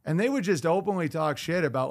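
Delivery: usual speaking voice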